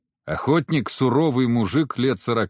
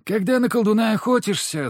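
higher-pitched female hunter, explaining; женщина-охотница, поучающая; 10 semitones higher than usual. A narrator's reading. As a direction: lift the voice into a knowing woman's lecture. Narrator voices female hunter explaining. (поучающий женский голос, повыше)

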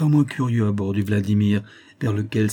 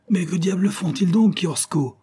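higher-pitched indignant question; baffled exclamation; 9 semitones higher than usual. A narrator's reading. Elim asks his sailor about the gunfire, baffled. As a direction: demand to know what they're doing. Lieutenant baffled at being fired on.

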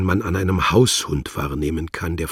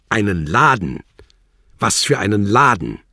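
louder, higher incredulous official; scornful questioning; 5 semitones higher, 8 dB louder than usual. Acted. Wladimir questions Worlock about his shop, loud and scornful. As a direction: drill into his answer.

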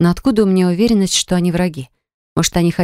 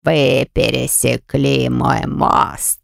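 very slow, lower troll voice; slow heavy troll growl; 5 semitones lower, much slower than usual. Acted. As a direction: drag it out, deep troll. Troll demands payment.

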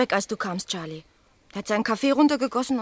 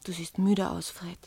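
ordinary talk, nothing marked

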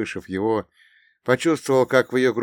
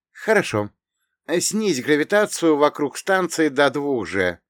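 commanding heroine; 7 semitones higher, 4 dB louder than usual. Acted. Irina gives commands.

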